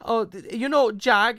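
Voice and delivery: hint of a worried maid; lightly voiced housekeeper. Housekeeper breaking bad news to Duncan, hesitant.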